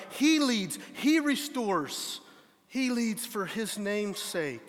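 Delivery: "He" lifted and held in each line; slow repeated stress on "He". Shown as a demonstration. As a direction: land on "He" every time. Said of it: "Do you notice the emphasis on that?".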